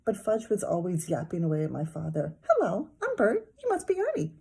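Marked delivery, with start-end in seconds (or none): 2.5-4.3 high puppet voice; Muppet voice. A narrator's reading. Toddler playing Muppets with Dad, high puppet voice. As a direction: chirp like Bert.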